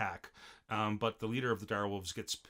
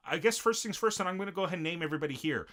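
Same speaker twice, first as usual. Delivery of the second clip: eager mock announcement, pitched up; excited, higher and fast; 8 semitones higher, faster than usual. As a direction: rattle it off high and quick. Voicing Rimuru deciding to name.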